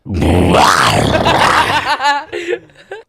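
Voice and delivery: bursting out laughing; loud laugh; loud laughter. Real, not acted. Cracking up before intro.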